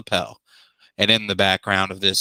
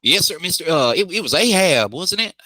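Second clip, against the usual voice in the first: put-on a light Australian accent for the clerk Helmet; Aussie accent; 7 semitones higher than usual. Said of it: "get back into that Aussie accent".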